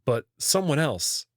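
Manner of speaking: ordinary delivery, nothing marked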